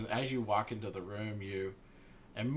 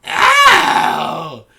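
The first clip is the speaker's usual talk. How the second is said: high, loud cackling hag voice; hag greeting guests; 7 semitones higher, 18 dB louder, much slower than usual. Acted. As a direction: greet them like a hungry old hag. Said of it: "She turns around and goes".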